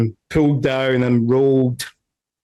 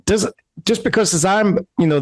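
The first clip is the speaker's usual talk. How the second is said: higher-pitched quick objection; raised, insistent protest; balcony guard; 7 semitones higher, faster than usual. Acted. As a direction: start arguing back from the balcony. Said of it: "the guy up on the balcony, he's saying".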